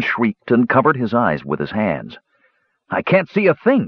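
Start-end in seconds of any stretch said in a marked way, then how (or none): 2.9-3.9 high-pitched cry of dismay; distressed cry. A narrator's reading. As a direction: cry out that you're blinded, voice jumping high. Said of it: "he cried".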